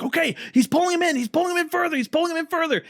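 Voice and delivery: high, excited director calling out directions; high director's call; imitating Spielberg yelling. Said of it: "Spielberg's yelling at the actors like".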